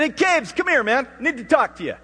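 loud, high summons; calling out. Retold or imitated. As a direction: holler for your son to come over. Retells calling his son in.

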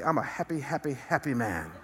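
a light, laughing imitation of the Duck Dynasty guy; Duck Dynasty catchphrase. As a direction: toss off the catchphrase with a chuckle. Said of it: "as the Duck Dynasty guy would say".

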